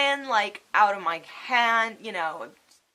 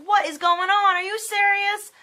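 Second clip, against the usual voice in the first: high, loud acted disbelief; retold upset outburst; 10 semitones higher, 5 dB louder than usual. Patient learning of spinal stenosis, upset and incredulous. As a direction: reenact being upset, pitch pushed way up. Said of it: "I'm really fucking upset at this point".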